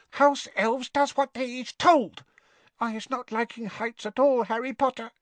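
high-pitched, dutiful elf voice; firm house elf. A narrator's reading. Winky tells Harry of elf duty, firm squeak. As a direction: insist in a tiny squeak. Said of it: "said Winky firmly".

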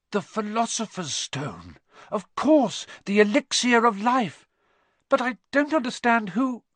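high, loud burst of sudden understanding; excited boy's realisation. A narrator's reading. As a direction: exclaim as the answer dawns on you.

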